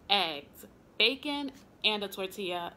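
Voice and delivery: ordinary talk, nothing marked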